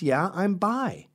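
high, slow affected delivery. Retold or imitated.

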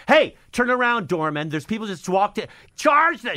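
high, loud yell at the doorman; comic re-enacted stage yell. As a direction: shout it out from the stage. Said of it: "I'm yelling from stage".

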